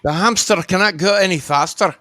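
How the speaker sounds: high-pitched mock Scottish plea; mock Scottish engineer. Listener joining the captain bit, mock Scottish engineer. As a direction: play along as a straining Scottish engineer.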